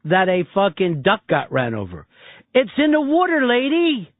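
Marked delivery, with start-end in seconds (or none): 2.6-4.1 higher impatient Philly voice; mock Philly accent. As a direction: talk down to her like a Philly local. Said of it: "you're just calling her an idiot".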